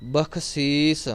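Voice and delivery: ਬਖਸ਼ੀਸ਼ said slowly with a plain ਸ, no dot sound; ਬਖਸ਼ੀਸ਼ ਸਾਫ਼ ਉਚਾਰਨ; correct pronunciation. Shown as a demonstration. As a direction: say ਬਖਸ਼ੀਸ਼ slowly, without the nukta. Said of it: "ਗੁਰੂ ਸਾਹਿਬਾਨ ਨੇ ਨਹੀਂ ਲਾਈ".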